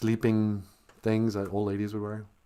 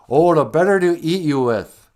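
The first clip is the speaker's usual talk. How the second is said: louder, higher big-bad-wolf line; hammed-up wolf threat; 7 semitones higher, 10 dB louder than usual. The wolf revealing himself to Red, loud mock menace. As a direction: go for the kill with a mock-menacing growl.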